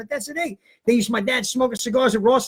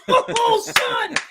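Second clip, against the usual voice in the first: proud dad; 12 semitones higher than usual. Retold or imitated. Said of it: "My dad goes".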